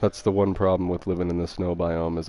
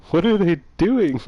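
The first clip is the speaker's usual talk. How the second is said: startled; 11 semitones higher, 8 dB louder than usual. Real, not acted. Hears neighbors banging upstairs.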